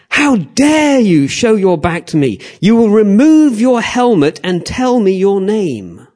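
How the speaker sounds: loud, high, slow imperial outrage; irate emperor. Acted. Emperor demanding the gladiator remove his helmet, irate and loud. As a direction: command him angrily, drawing out the words. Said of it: "he gets irate and he says".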